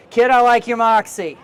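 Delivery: loud curmudgeonly editor prodding a student; Stanley. Acted. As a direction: play a cranky old school newspaper editor pushing a kid. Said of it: "I don't know what this, this accent is that has come out of me".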